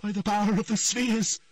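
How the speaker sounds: straining incantation. A narrator's reading. Triss begins her spell, straining and high. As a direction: summon the last of your strength. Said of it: "moaned the magician".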